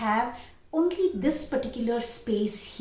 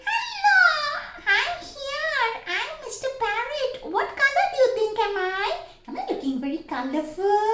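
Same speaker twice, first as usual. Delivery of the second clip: much higher puppet parrot voice, playful; Mister Parrot voice; 13 semitones higher, 4 dB louder than usual. Shown as a demonstration. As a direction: squeak like a cheerful parrot puppet. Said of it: "you can talk like that".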